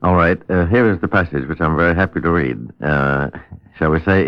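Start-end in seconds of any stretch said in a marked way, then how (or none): none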